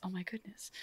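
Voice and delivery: a breathy whispered gasp of gossip; scandalised whisper to a husband. Retold or imitated. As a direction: whisper it to your husband in the chambers. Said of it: "whispering with your husband".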